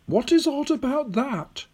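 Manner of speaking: put-on high old lady's voice; old woman, questioning. Old woman answering the puzzled boy, high and questioning. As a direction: query it in a high, elderly voice.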